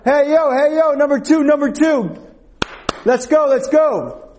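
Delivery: high, urgent sideline holler; coach yelling out. Retold or imitated. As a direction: call out to players from the bench. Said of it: "She would yell out".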